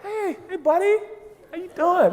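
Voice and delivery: high, soft, chummy greeting; mock-gentle, easygoing hello; casual buddy tone. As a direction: greet them lightly in a small high voice. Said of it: "the tone is just like".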